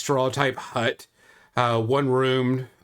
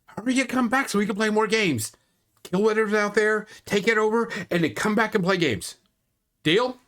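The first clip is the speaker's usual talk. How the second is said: put-on higher, eager child voice; 9 semitones higher than usual. Child begging the party to come back and play. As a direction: bounce through it like an excited kid. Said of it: "she kinda hugs".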